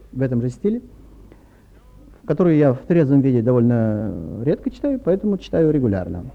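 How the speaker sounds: usual speaking voice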